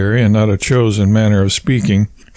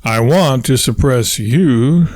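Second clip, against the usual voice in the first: ordinary delivery, nothing marked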